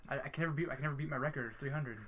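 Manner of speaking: ordinary talk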